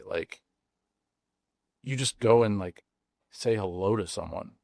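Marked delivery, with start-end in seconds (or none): none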